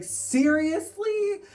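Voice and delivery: slow, high, loud incredulous seriously; fed-up outburst at the beer spiller; exasperated yell. Retold or imitated. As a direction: drag it out in disbelief. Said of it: "And I was like".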